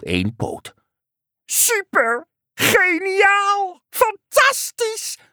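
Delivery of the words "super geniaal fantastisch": very high, thrilled frog voice; super-enthusiastic frog croak. A narrator's reading. Frog praising the one-legged hop, super-enthusiastic. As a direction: gush each word like an ecstatic frog. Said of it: "kwaakte de kikker superenthousiast".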